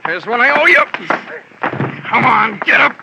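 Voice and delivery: roaring as he lands blows; furious fight. Acted. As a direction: hit him with every word. Johnny punching Victor.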